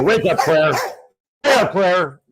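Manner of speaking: high, loud call to rouse someone; playful wake-up call. Pretend. Host rouses sleepy Claire in the chat, loud playful call.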